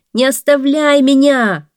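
high, loud desperate cry; man in the well crying out not to be left; shouting man. A narrator's reading. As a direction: yell up out of the well. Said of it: "снова закричал человек".

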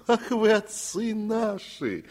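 high, slow fawning cry; exclaiming fawningly. A narrator's reading. Sofron cries out to the master, fawning. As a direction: cry out, overcome with devotion. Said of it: "воскликнул Софрон".

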